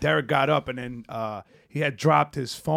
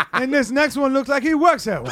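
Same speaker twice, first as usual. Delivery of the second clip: laughing shout; 8 semitones higher than usual. Acted. Comic roasting the roasters, laughing shout. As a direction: yell the joke while cracking up.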